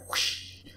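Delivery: soft, voiceless whoosh noise; imitated whoosh. Player voices his attack, soft whoosh.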